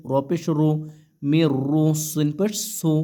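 ordinary talk, nothing marked

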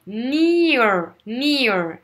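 near stretched very slowly, I then E; exaggerated diphthong. Shown as a demonstration. Teacher exaggerating for students: slow near. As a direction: drag out near, gliding from I to E. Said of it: "J'exagère, hein".

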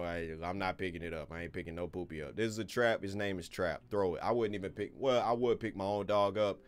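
usual speaking voice

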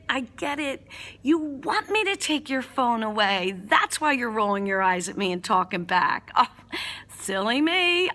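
put-on drip sarcasm at a teenager; sarcastic. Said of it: "get a little sarcastic right back with them".

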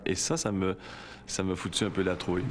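normal talking voice

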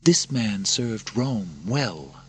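tender princess. Acted. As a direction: remind him gently who he was. Narrator voices Lucilla.

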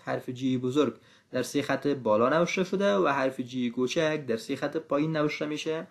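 normal talking voice

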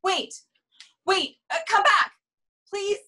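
high, rising call to come back; calling after. Acted. Saleswoman calls after the departing neighbor, high and desperate.

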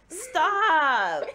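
loud, high, drawn-out yell; falling wail of protest; exasperated shout. Real, not acted. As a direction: shout to cut off the teasing. Begging opponent to stop.

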